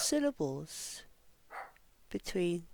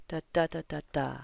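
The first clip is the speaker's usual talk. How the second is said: second and last "da" stressed, final one lower; rhythm in da; 3 semitones lower, faster than usual. Shown as a demonstration. Teacher demonstrates the next phrase's beat, stressed "da" rhythm. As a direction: chant the stress pattern on "da". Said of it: "say da instead of words".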